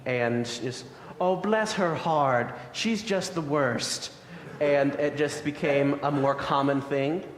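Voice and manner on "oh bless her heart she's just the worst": slow, higher mock-genteel tea-party gossip; a sweetly catty Southern lady over tea; Southern society lady. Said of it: "Southern upper class women were chatting for tea".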